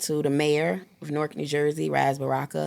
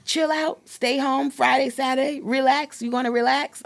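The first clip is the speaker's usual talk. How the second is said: put-on much higher child's voice asking mom to relax; higher child voice urging mom to rest; imitating her son; 9 semitones higher than usual. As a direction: play the son checking his mom will rest. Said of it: "He's like".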